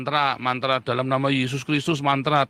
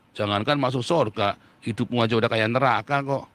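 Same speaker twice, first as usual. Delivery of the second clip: normal talking voice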